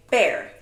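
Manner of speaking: "Bear" called out loud for the class to echo; teacher calling "Bear" out to the class; modelling a word. Shown as a demonstration. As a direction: say "Bear" clearly and loudly as a model. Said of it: "can everyone clap and say".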